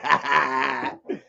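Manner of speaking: low, soft laugh; short laugh. Real, not acted. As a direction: laugh softly at a chat comment. Own laugh reading chat.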